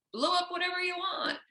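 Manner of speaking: high, breezy mock voice; carefree American. Speaker mocks American culture in a high breezy voice. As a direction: toss it off like anything goes. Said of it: "but we're like".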